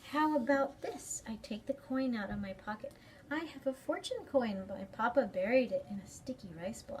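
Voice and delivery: put-on lively read-aloud for kids; story time reading. A narrator's reading. Librarian reading a picture book to children, lively. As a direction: read it brightly to a young audience.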